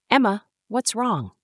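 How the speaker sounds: a mother's alarmed concern; sharply concerned, pitch lifted. A narrator's reading. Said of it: "Her voice was sharp with concern".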